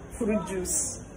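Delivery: usual speaking voice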